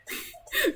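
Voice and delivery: sudden high-pitched laugh. Real, not acted. Streamer laughing at Coco's chat, high laugh. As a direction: burst out laughing.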